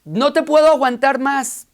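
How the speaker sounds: rabbi snapping at the rude parrot; fed-up rabbi. Acted. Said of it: "Le dijo el rabino, "Ya estoy harto".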